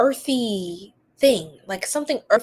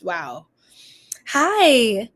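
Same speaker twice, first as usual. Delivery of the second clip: ordinary talk, nothing marked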